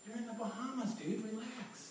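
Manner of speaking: mock calming. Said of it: "And I'm like".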